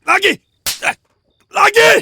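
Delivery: high, very loud shrieked "lagi"; screaming again. Acted. Captor screams at captive to hit again, shrieking. Yells for more slaps.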